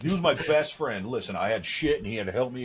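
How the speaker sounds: normal talking voice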